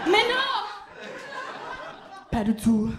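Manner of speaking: high, loud theatrical "Mais non!"; mock French outrage; hammed-up French no. Dramatic French exclamation.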